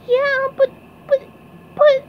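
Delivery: high, loud broken stammer; sputtering. A narrator's reading. SpongeBob refused by Patrick, sputtering. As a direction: sputter in a squeaky cartoon voice. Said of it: "said SpongeBob, sputtering".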